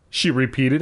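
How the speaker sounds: ordinary delivery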